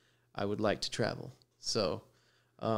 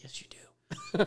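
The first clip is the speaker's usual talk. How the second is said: slow, breathy whispered insistence; hushed, drawn-out retort; mock whisper; 6 semitones higher, 3 dB quieter, much slower than usual. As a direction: whisper it slowly like a secret. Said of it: "He's like".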